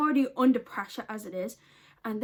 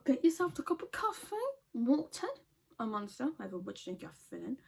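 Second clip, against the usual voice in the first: normal talking voice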